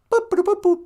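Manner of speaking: very high robotic boop boop; chirpy mock computer beeps. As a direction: beep like a little computer.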